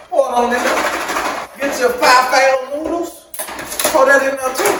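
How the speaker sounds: high, hyped-up teasing; playful goading. Pretend. Host egging viewers on to pour noodles, playful goading.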